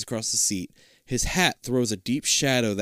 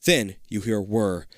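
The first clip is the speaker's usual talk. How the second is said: ordinary delivery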